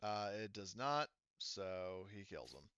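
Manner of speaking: ordinary delivery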